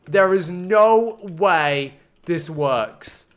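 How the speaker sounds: very slow, loud, word by word; emphatic disbelief. Real, not acted. Streamer stunned by the build, slow loud disbelief. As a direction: draw out each word forcefully.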